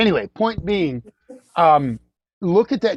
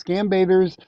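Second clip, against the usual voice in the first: normal talking voice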